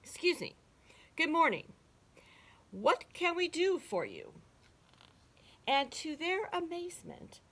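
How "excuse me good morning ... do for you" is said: higher-pitched, polite frog spokesman; chief frog. A narrator's reading. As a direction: politely address a stranger in a raised voice. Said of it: "the chief frog spoke up".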